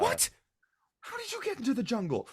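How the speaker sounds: high, fast, incredulous outburst; shocked mother. Acted. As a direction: demand an answer in alarmed disbelief.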